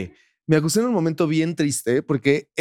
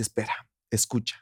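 much softer, hushed request; 5 semitones lower, 9 dB quieter than usual. Retold or imitated. Boyfriend softly asks her to listen in the car. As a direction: hush her so she will listen. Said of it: "me dice".

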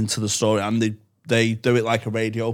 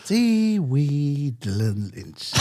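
ASMR voice; slower than usual. Shown as a demonstration. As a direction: lull her to sleep slowly. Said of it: "So I just do that A S M R voice".

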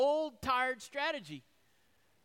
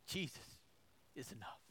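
hushed; 8 semitones lower, 16 dB quieter than usual. Real, not acted. Tender climax of sermon.

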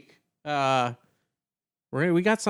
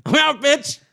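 high, loud and cocky; cocky player's farewell; 11 semitones higher, 6 dB louder than usual. Retold or imitated. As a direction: crow it like a brag. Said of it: "he was like".

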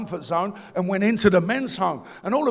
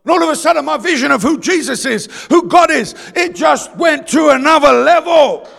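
loud, raised preacher voice; full-force preaching; 6 semitones higher, 9 dB louder than usual. Real, not acted.

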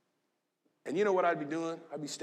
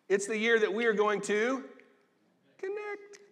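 cute high connect; 5 semitones higher than usual. Shown as a demonstration. Pastor playfully teasing his church, connect squeaked high. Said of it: "Am I trying to be cute? Yes".

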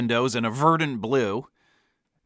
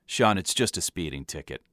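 low, soft, dismissive dad; weary dad; 6 semitones lower, 5 dB quieter than usual. A narrator's reading. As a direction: brush the kid off in a lower voice. Dad brushes off son's question.

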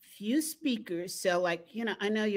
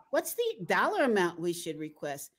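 ordinary delivery

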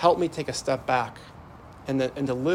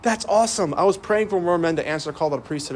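higher, louder, thrilled exclamation; elated brother; 5 semitones higher, 4 dB louder than usual. Retold or imitated. Brother hearing of the priest calling, higher and louder with elation. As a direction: burst out with joy at the news. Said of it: "And he's, like, elated".

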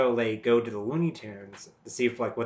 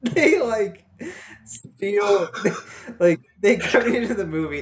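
high, loud, talking through laughter; can't stop laughing; laughing while talking; 10 semitones higher, 9 dB louder, slower than usual. Real, not acted. Laughing through her pitch.

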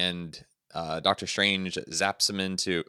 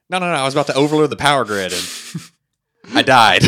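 fast, louder, higher mock protest; Electro protesting; 6 semitones higher, 9 dB louder, faster than usual. Podcaster mimicking Electro's indignant excuse, loud and fast. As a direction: rush it out in hammed-up outrage, then bark the last words. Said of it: "And Electro's like".

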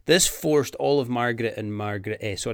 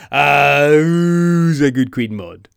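put-on slow, loud baby talk to a pet; talking to a dog; 3 semitones higher, 11 dB louder, much slower than usual. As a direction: coo at her like a good doggy. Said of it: "makes her sound like a Doug".

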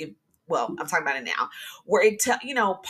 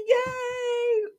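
high, squealing yay; excited cheer. Real, not acted. Host cheering her episode count for listeners, excited squeal. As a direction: cheer it like a little victory. Celebrating reaching episode thirteen.

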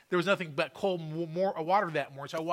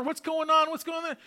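alarmed onlookers; 9 semitones higher than usual. Retold or imitated. People rushing round the corner.

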